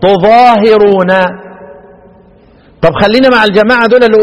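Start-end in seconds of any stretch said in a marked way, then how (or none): 0.0-1.1 "تظاهرون" as tu-ẓaa-hi-ruu-na, slow and loud; Asim's reading. Shown as a demonstration. Teacher showing students how Asim reads "تظاهرون", tu-ẓaa-hi-ruu-na, drawn out. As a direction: draw out "تظاهرون" with u on ت, single ظ, i on هـ. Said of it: "يبقى يقرأ ايه؟".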